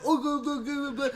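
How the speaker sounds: high-pitched babbling mock voice; mocking her imitation. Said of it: "I know, she's like".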